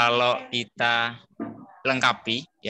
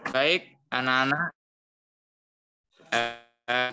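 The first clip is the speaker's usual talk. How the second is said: broken-up online call audio; choppy audio; 2 semitones higher, 4 dB quieter than usual. Real, not acted. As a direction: voice cutting in and out over a bad connection. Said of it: "Suaranya putus-putus". (suara putus-putus)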